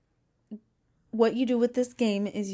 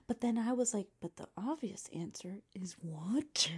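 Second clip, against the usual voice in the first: whispering; 6 dB quieter than usual. Real, not acted. Keeping quiet at bedtime.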